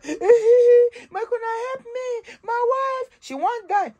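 high, slow, wailing imitation of a desperate beg; mock crying plea. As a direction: wail like a husband begging strangers to save his wife. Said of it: "He come dey cry".

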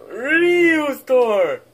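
Ryu's door! wailed high and loud; mock wail. Shrek wails for his lost Ryu's door, a hammed-up loud cry. Kids mock-mourning a toy.

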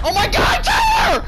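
high yelled warning; panicked shout. Real, not acted. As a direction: scream his name in panic. Teammate in danger, yelling.

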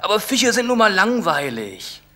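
put-on high childish whine; whiny kid. Boy whining to father about fish, high whine. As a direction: sulk like a bored boy.

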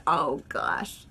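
ordinary delivery

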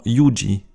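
"yuji" slowly, starting with a y glide; "yuji" drawn out as yu-dji. Shown as a demonstration. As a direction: open "yuji" on a y sound. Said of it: "et y".

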